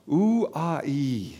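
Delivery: "Ouai" sounded oo-ah-ee, drawn out and falling; Greek ouai. Shown as a demonstration. Pastor repeating the Greek word for the listeners, letting it fall. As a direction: let "Ouai" sigh out as oo-ah-ee. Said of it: "And you can all say that".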